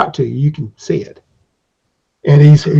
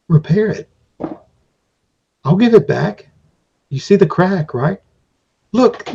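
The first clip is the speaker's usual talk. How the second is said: pleading craftsman. Acted. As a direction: win back the hero's trust gently but urgently. Craftsman reassuring hero.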